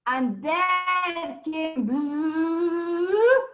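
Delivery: long drawn-out rising bzzz; imitated swirling wind; whirlwind buzz. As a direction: stretch it out into a whooshing buzz. Storyteller imitates the whirlwind arriving.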